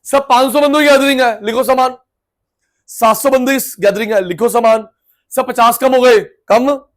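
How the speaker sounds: a flustered host rattling off changes; excited caller. Retold or imitated.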